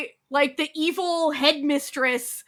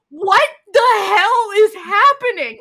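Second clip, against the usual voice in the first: higher, louder and slow, in terror; panicked shout; 7 semitones higher, 9 dB louder than usual. Retold or imitated. Wife waking up buried in a grave, panicked and loud.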